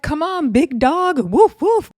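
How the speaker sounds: high, loud, slow taunt ending in barks; imitating Bahati barking to mock Daisy. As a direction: tease the big dog, bark at her. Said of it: "Bahati's like".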